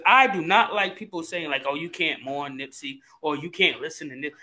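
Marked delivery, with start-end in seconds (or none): 1.7-4.4 low-pitched imitation of naysayers; mock scolding. Quoting people who forbid mourning [name], low mock scolding. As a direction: drop low and softer to quote the scolds.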